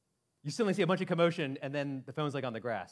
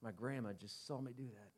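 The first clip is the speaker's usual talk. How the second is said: mock sheepish apology; 3 semitones lower, 11 dB quieter than usual. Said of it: "Aisha's like".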